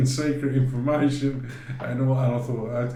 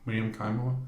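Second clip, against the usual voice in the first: ordinary talk, nothing marked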